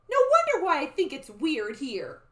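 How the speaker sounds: high, loud exclamation; child's protest. Acted. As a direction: call it out as an indignant young character. Annie protests in the story.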